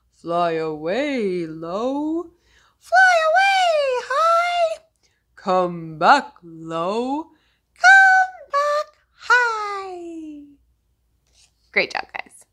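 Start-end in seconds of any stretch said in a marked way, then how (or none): 0.1-2.3 deeper slow voice; low voice. Shown as a demonstration. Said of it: "one named Low". 2.8-4.8 much higher and louder squeak. Shown as a demonstration. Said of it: "one named High". 5.3-7.2 deeper slow voice; low voice. Shown as a demonstration. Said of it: "one named Low". 7.7-10.4 much higher and louder squeak. Shown as a demonstration. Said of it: "one named High".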